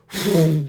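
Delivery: loud breathy rushing noise; sudden loud whoosh; imitated train whoosh. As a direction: mimic a train bursting past with your mouth. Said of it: "that's the sound it makes".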